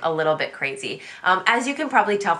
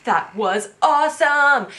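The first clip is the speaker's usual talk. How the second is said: higher, louder, slow gush; 8 semitones higher, 5 dB louder, slower than usual. Retold or imitated. High-energy wife raving about the movie to her family, slow and loud. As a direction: stretch it out like you loved every second. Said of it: "And I'm over here like".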